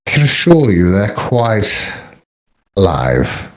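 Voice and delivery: measured actor impression with a deep final word; Alan Rickman. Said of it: "was that Alan Rickman?".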